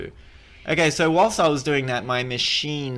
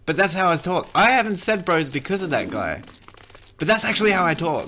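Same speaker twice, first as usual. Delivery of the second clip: indignant protest; 5 semitones higher, 4 dB louder than usual. Real, not acted. Defends himself against the mocking.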